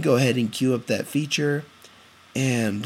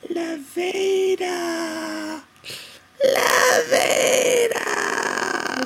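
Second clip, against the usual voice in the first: ghostly wail; much slower than usual. Pretend. Teasing spooky wail.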